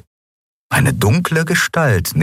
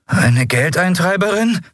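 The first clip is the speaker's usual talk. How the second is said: put-on much higher, young wary question; Miko asking; 12 semitones higher than usual. Miko wonders if a debt collector comes, high uneasy question. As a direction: ask it like a nervous kid. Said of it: "fragte Miko".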